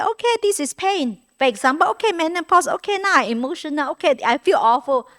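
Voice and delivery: calm acceptance. Pretend.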